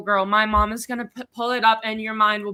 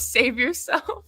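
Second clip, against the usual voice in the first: Joker voice; 3 semitones higher, 3 dB quieter, slower than usual. Pretend. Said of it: "Joker voice".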